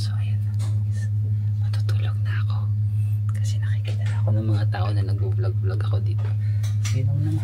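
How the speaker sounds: embarrassed whisper. Real, not acted. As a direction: whisper so strangers won't hear. Whispering, people watching her vlog. (pabulong na nahihiya)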